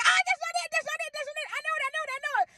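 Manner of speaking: very high, squealing eager cry; bouncing with impatience, desperate to answer; frantic excitement. Retold or imitated. Caller on hold squealing at a wrong answer, very high and frantic. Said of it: "I was like".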